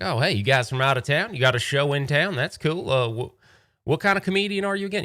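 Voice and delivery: lightly put-on desert local, rising to a quick high question; Arizonan tour guy. Guide quizzes the comedian about his act, high and chatty. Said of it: "very Arizonan".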